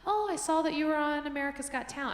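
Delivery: a hint of a nail lady. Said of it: "the lady doing my nails was like".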